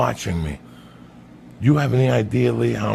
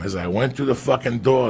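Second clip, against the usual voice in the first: normal talking voice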